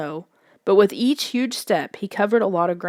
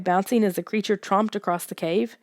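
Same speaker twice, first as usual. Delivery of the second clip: plain reading voice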